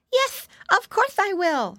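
put-on high, curious piggy boy; little pig voice. A narrator's reading. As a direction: wonder aloud like an eager piglet. Flop answers sleepily.

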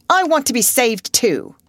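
high, loud plea for rescue; pleading cry. A narrator's reading. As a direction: cry out to be taken along. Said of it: "he cried".

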